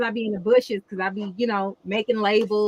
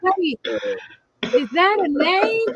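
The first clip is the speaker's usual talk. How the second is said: higher, slower puzzled question; mock puzzled host; 8 semitones higher, slower than usual. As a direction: play her baffled double take. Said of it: "She's like".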